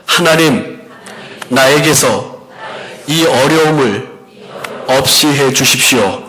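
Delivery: slow, measured prayer for others to repeat; repeat-after-me prayer. Shown as a demonstration. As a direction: lead them phrase by phrase, pausing after each. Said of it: "여러분도 한번 저를 따라하겠습니다".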